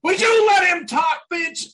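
high, loud outburst at an interrupter; exasperated shout. Real, not acted. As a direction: shout down the person cutting in. Scolding co-host for interrupting.

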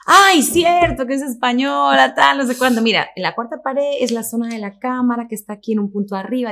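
high, loud mock realization, then explaining; effusive casting director. Casting crew realizing she is Spanish, loud and effusive.

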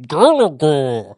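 loud, slow, garbled arcade-game announcer; bad eighties voiceover. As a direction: boom out "Galaga" like a cheesy old game voice. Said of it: "It should be in bad eighties voiceover".

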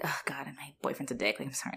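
softly whispered sorry; whispered apology. Retold or imitated. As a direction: whisper it aside, embarrassed for him. Girlfriend apologizing under her breath.

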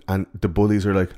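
ordinary talk

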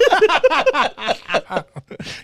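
loud high-pitched cackling laugh; high cackle. Real, not acted. Cracking up at his own story with friends, high cackle.

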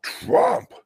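ordinary talk, nothing marked

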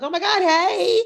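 thrilled fan greeting; excited greeting. Retold or imitated. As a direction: squeal hello like you've spotted a favorite star. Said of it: "I'm like".

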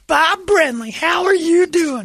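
high, loud, slow put-on hello; hammy greeting. [name] greeting Brenly in locker room, high loud slow. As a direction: greet him like an old pal.

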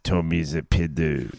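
low mock French; French accent. Teasing co-host in a low French accent. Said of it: "in a French accent".

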